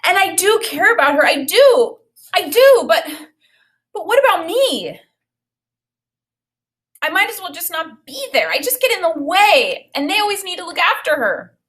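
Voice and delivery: put-on upset boy pleading that he matters too; wailing child. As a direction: plead through tears, a boy feeling unwanted. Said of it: "He started wailing again".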